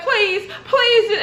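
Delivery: high-pitched mock pleading; freaking out. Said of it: "she starts freaking out".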